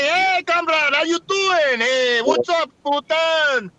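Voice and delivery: high, drawn-out buddy greeting in bad Russian accent; hammed-up Russian drinking-buddy voice; botched Russian accent. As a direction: hail a pal in a muddled Russian accent. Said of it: "Is that his Russian accent?".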